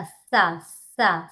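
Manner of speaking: సా with tongue at the teeth; dental sa. Shown as a demonstration. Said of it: "దంత సకారం".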